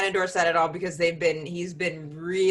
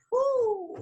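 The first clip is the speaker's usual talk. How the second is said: high whistle of amazement; high whoo. Real, not acted. Exclaiming at the expense.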